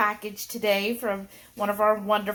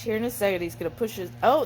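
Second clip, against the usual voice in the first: ordinary talk, nothing marked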